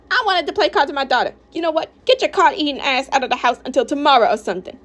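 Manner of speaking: high-pitched angry ordering; fed-up mother in a quarrel; indignant outburst. Acted. Mom throws partner out.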